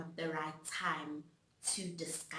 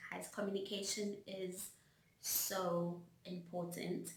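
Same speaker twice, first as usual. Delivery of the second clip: normal talking voice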